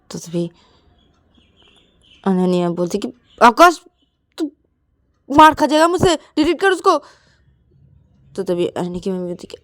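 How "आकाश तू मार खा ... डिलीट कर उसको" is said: high, loud warning; angry threat. Acted. Girl threatens boy to delete photo, angry. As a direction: threaten to hit him if he won't delete.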